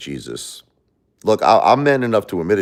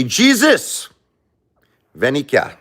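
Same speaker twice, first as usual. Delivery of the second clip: calling out to Jesus; 8 semitones higher, 3 dB louder, slower than usual. Pretend. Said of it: "I'm gonna say".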